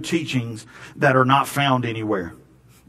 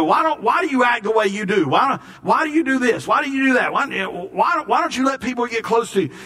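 high, pleading sidekick voice; squeaky pestering Donkey nagging his friend; 9 semitones higher than usual. Retold or imitated. Preacher mimics Donkey pestering Shrek, high and pleading. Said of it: "and Donkey's getting onto it".

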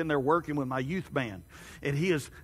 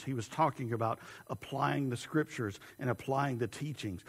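normal talking voice

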